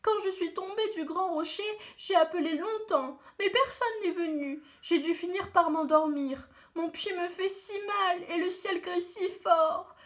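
a hint of a frightened child in pain; hurt little boy.

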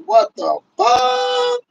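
high, slow, stunned; drawn-out disbelief. Retold or imitated. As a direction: stretch it out in disbelief. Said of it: "I said".

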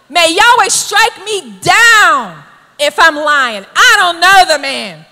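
slow, loud, indignant oath. Acted. Preacher acts out Peter's third denial, bold and indignant. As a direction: swear it boldly, calling down a curse. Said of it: "it's just indignant".

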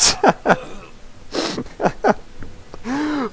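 high wheezing laugh. Real, not acted. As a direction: burst out in a helpless laugh. Laughing at enemy's blunder.

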